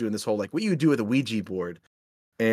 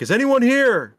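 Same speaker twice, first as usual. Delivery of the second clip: high, loud call into the dark; yelling into the woods; 13 semitones higher, 7 dB louder than usual. Retold or imitated. Friend calling out loudly to the ghosts in the dark woods. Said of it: "He starts, like, yelling into the woods".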